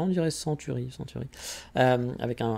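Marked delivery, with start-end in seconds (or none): 0.3-1.3 softly trying out Century with a French accent; muttered, French-accented "Century"; rotten accent. Shown as a demonstration. Vlogger mocking own English, quietly in a rotten French accent. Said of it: "un accent pourri".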